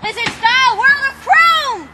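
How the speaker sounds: villain voice. Acted. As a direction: boom it like a vain villain.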